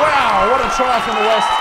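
loud, breathless wow after the try; thrilled reaction. Real, not acted. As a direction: still riding the high of the score.

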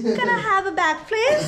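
high, rising imitation of Essex accent; attempted British accent. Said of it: "teach me your British accent".

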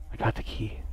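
breathy secret whisper. Real, not acted. Sneaking streamer whispers about finding the key, hushed. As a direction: keep it under your breath so nothing hears. Sneaking through scary house.

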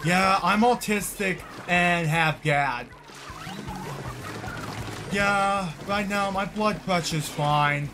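ordinary delivery

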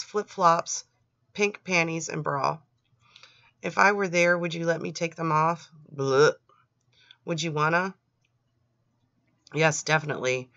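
ordinary delivery

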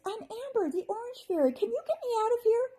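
high, fast little fairy voice; a trapped fairy pleading quickly; high bright fairy asking for help. A narrator's reading.

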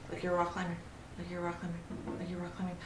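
soft, repetitive self-coaching; focused murmur. Real, not acted. Player coaxing the climber along, hushed focus. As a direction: murmur it under your breath, concentrating.

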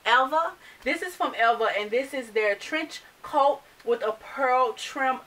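usual speaking voice

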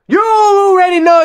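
shouted high and slow, building to a peak; hype shout. Real, not acted. Hyping viewers for the reaction, shouted high and slow. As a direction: yell it out to pump up the viewers. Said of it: "third take shouted".

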